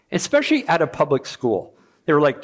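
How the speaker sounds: ordinary talk, nothing marked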